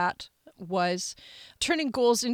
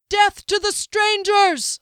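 high, loud, drawn-out battle cry; furious mob rallying shout; enraged cry; 12 semitones higher, 8 dB louder, slower than usual. Acted. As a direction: shout it slowly with rage, pitched way up. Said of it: "a voice cried with rage".

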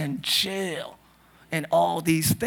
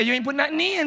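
imitating a Chicago accent, pitched up; 7 semitones higher, faster than usual. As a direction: mimic her Chicago accent. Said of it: "I like your accent".

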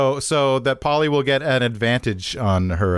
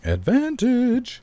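high drawn-out call; 12 semitones higher, much slower than usual. Real, not acted. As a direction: stretch it high and slow. Chanting the word advantage.

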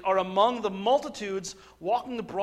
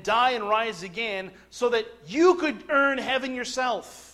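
higher, slow and weighty pulpit delivery; emphatic preaching; 5 semitones higher, 7 dB louder, slower than usual. Real, not acted. Preacher telling congregation grace cannot mix with works, forceful slow preaching. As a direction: stretch the phrases out slowly with preacher's force.

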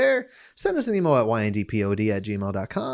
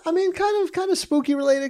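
high put-on voice. Continues high silly voice.

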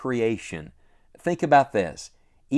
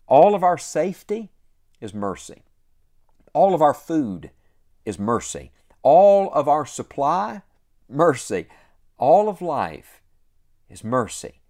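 preaching litany; 3 semitones higher, 5 dB louder than usual. Acted. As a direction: build the list like a rising call-and-response. Preaching litany building to climax.

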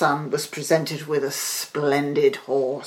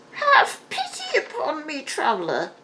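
high pleading crone; an old hag begging for pity; high witchy plea; old beggar woman; 10 semitones higher than usual. A narrator's reading. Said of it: "she said again".

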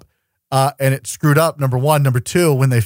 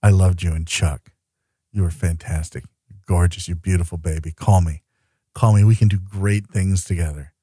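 seductive low voice; 8 semitones lower than usual. Pretend. Mock seductive address to Yvonne.

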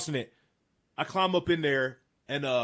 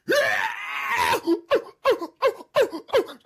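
loud, high chuckling bugle imitation; bull chuckle; 5 dB louder than usual. Hunter mimicking the bull answering right behind him. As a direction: bark out a bull elk's chuckle.

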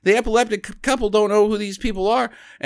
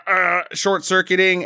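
normal talking voice

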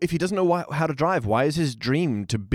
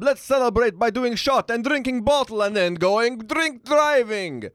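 Russian accent impression; 9 semitones higher than usual. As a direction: chant it like a rowdy cartoon Russian. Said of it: "that was our impressions of Slava".

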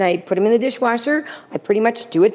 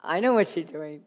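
knowing husband; 7 semitones lower, 8 dB quieter than usual. Retold or imitated. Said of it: "he'll turn to me and go".

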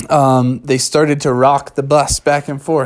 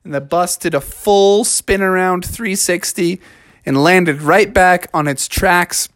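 ordinary delivery, nothing marked

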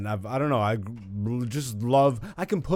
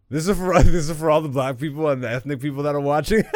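laughing; 3 semitones higher, 6 dB louder, faster than usual. Real, not acted. Laughing at his own joke.

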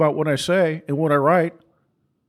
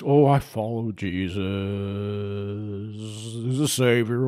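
mocking shallow believer; 7 semitones lower, 5 dB quieter, slower than usual. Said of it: "People try to dumb down Jesus Christ".

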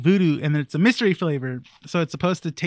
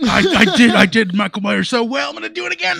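smug director voice; 6 semitones higher, 5 dB louder than usual. Pretend. Mocking the director's ego.

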